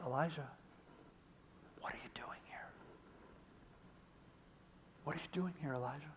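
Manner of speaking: very soft, hushed voice of God; hushed and tender, barely voiced; gentle whisper. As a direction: call to him in a near whisper. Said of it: "The gentle whisper".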